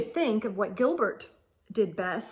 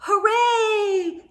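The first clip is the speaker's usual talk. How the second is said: higher, louder cheer; joyful child's shout; shouted hooray; 10 semitones higher, 6 dB louder than usual. A narrator's reading. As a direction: cheer it out like a happy boy. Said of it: "Gilbert shouted".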